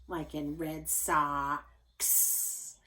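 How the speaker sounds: Sox stretched slowly, ks at the end held; slow on Sox to show the ending; drawn out Sox. Shown as a demonstration. Teacher showing X at the end of a word, slow. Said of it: "it says that X".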